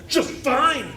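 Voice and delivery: Bobby's bratty high reply; cheeky kid. Acted. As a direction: pipe up like a sassy little boy. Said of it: "And Bobby said".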